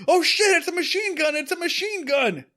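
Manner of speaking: high-pitched mock alarm; hammed-up frightened cry; retold panicked yelling. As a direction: yelp it like crooks panicking. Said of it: "criminals start yelling".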